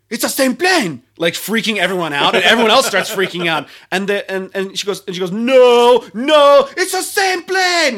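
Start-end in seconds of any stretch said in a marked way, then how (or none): 0.1-1.0 higher, louder alarmed repeat; alarmed cry. Retold or imitated. Passenger panics, freaking everyone out. 5.3-8.0 high, loud yell toward the front, slow at the end. Retold or imitated. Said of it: "Like, yelling out to the front".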